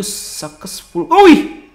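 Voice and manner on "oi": loud high call out; loud startled oi; sharp shout. Real, not acted. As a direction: yell to grab attention. Shouting at vanished baby.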